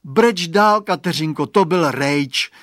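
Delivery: fed up that it was only a turnip; disgusted. Acted. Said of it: "řekl znechuceně Radovan".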